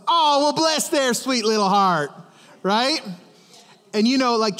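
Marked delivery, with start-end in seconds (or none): 0.1-2.1 imitated Southern lady, higher-pitched sweetness; sugary Southern twang. Southern lady about someone she isn't fond of, sugary twang. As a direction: put on a syrupy down-South twang over veiled dislike. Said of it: "she had this thing that she would say".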